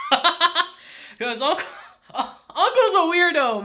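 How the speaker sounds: high, laughing through the words. Real, not acted. Commenting on weirdo Uncle to viewers, laughing. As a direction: crack up while trying to get it out.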